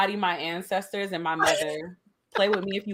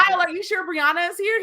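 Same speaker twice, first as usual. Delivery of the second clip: mock innocent question; 10 semitones higher than usual. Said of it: "I was like".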